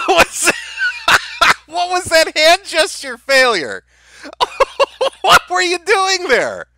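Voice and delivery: high, laughing disbelief. Real, not acted. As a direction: speak through the laughter. Laughing at the clip.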